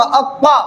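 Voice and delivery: अक्वा as a heavy, full-mouthed doubled emphatic t with rounded "aw"; thick heavy articulation. Shown as a demonstration. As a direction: thicken the consonant and vowel in अक्वा. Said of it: "मोटी आवाज में".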